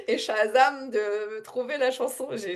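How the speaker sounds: usual speaking voice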